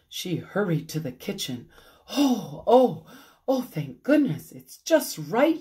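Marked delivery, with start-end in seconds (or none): 2.1-5.6 higher, louder relieved oh oh oh; relieved gushing. A narrator's reading. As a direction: rush of relief over the pie. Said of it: "she said".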